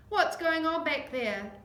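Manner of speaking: loud questioning call from the front. Acted. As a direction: call back from the tractor. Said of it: "she called".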